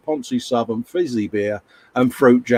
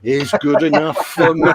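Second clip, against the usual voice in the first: laughing; 5 semitones higher than usual. Real, not acted. Joking about Robbie Fowler's moisturiser.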